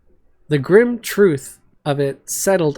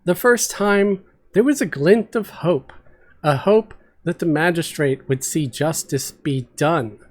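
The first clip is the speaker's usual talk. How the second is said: usual speaking voice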